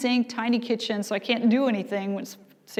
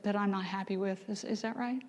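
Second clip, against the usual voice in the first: soft coaxing question; 11 dB quieter than usual. Retold or imitated. Gentle question to daughter.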